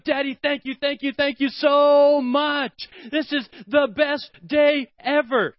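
put-on high, slow, gushing little girl thanks; a thrilled daughter thanking her dad; excited child. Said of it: "She's so excited".

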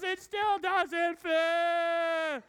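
put-on high, very slow whining child; whiny kid. Son whining to dad that it still won't fit.

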